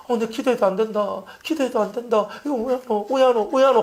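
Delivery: high-pitched fussy panic; making a big fuss; mock fretting. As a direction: fret as if prayer stopped working. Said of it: "호들갑 들고".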